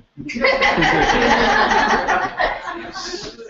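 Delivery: long laughing fit. Real, not acted. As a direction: crack up at the joke. Laughs after the punchline.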